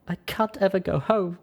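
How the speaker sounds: high, tearful voice; weeping. Acted. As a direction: weep it out in gasps. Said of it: "wept with tiny little gasping sobs".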